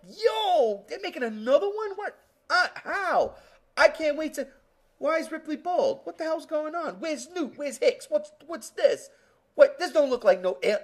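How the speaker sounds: high, loud rapid confused questions; excited bewildered fan. Retold or imitated. Fan reacting to Alien Three, high excited confusion. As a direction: fire off baffled questions like a stunned fan. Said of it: "I was like".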